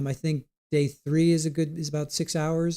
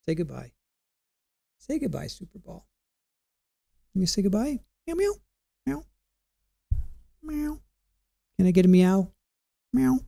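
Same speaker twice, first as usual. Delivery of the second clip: coaxing the cat; 3 semitones higher than usual. Real, not acted. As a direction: gently coax the cat over to the camera. Coaxing the cat, meowing at her.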